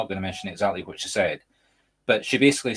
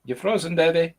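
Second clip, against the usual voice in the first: raised-pitch call to check the connection; 9 semitones higher than usual. Real, not acted. Host to frozen video guest, calling out. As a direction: call to someone who may not hear.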